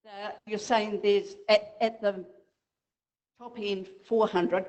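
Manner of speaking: usual speaking voice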